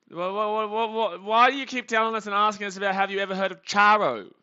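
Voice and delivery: real exasperation at the chat; exasperated. Real, not acted. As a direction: throw your hands up in mock outrage.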